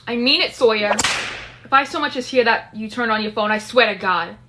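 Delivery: loud, firm telling-off; stern warning. Acted. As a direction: lay down the law loudly. Character sternly warns Sawyer to rest.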